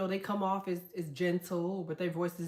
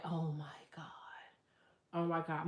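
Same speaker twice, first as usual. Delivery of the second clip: low hushed reaction, fading to a whisper; imitating a whispered swoon; retold hushed awe; 4 semitones lower, 7 dB quieter than usual. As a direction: act out being stunned, under your breath.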